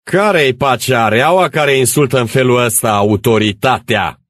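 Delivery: loud, high-pitched outrage; angry challenge. Acted. Old gendarme to the villagers, angry shouted challenge. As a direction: bellow it like affronted authority.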